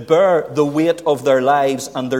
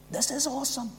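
much softer, higher, falling at the end; hushed awe; 12 semitones higher, 9 dB quieter, faster than usual. Real, not acted. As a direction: settle it down in quiet reverence.

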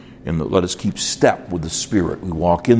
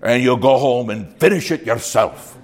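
Irish brogue; 4 semitones higher than usual. Retold or imitated. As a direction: imitate an old Irish preacher's brogue. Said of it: "So without the accent".

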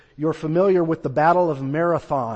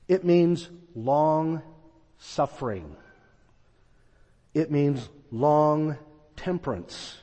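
slow emphasis; slower than usual. Shown as a demonstration. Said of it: "That idea of long temperance".

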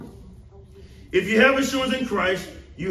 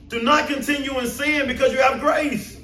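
ordinary delivery

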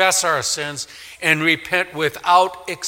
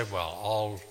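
smug; 5 semitones lower, 9 dB quieter than usual. Retold or imitated. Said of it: "smugly say".

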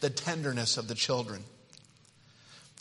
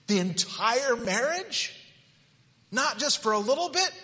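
mock disbelief; 10 semitones higher than usual. Mock incredulous groom.

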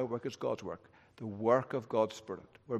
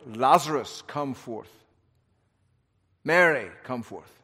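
summoning by name; 3 dB louder than usual. Pretend. God calling each believer by name, commanding call. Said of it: "there's a specific call, like".

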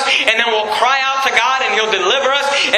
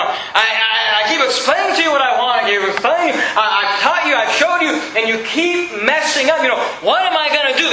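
higher, exasperated pleading; frustrated parent; 3 semitones higher than usual. Acted. Preacher as parent explaining yet again to children, frustrated and higher. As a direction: stammer into it, then let the exasperation climb. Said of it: "That's the frustration that God had".

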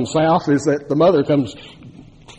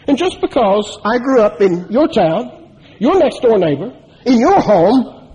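building preacher fervor; 7 semitones higher, 3 dB louder than usual. Real, not acted.

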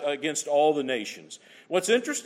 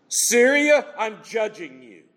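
booming judgment; 4 semitones higher, 6 dB louder, slower than usual. Retold or imitated. Preacher voicing God's judgment.

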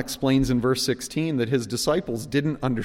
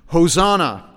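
raised crowd shout; loud acclaim; 6 semitones higher, 10 dB louder than usual. Retold or imitated. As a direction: call out like a crowd. Crowd cry quoted in sermon.

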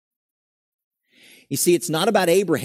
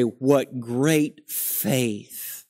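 mock awe; much slower than usual. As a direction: sigh it out like a wistful admirer. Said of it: "We look back at Abraham and say".

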